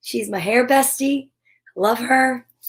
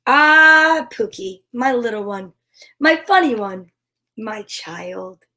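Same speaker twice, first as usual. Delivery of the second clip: slow, tender picture-book reading; reading to children; slower than usual. Acted. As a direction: read it slowly to a little one.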